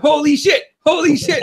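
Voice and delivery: high-pitched, excited repeat; amazed exclamation. Retold or imitated. Fan retells what the viewers were saying, excited amazement. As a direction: blurt it twice like thrilled spectators. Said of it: "We were going".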